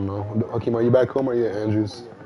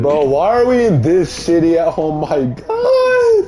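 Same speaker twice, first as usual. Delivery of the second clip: whiny outburst; 6 semitones higher, 4 dB louder, slower than usual. Real, not acted. Disgusted at the game.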